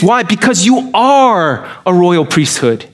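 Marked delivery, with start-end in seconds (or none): none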